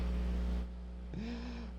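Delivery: airy unvoiced laugh; high breathy giggle. Real, not acted. Laughing at the story.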